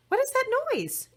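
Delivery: high, startled question; Princess Orchid voice. A narrator's reading. Orchid hears the ringing, high puzzled question. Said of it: "asked Princess Orchid".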